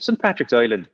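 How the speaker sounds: hammy Irish accent, Island as "Oiland"; bad Irish accent. Shown as a demonstration. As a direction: lay on a thick, bad Irish accent for Saint Patrick's Island. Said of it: "in a very bad Irish accent".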